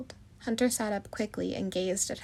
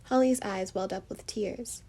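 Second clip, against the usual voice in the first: usual speaking voice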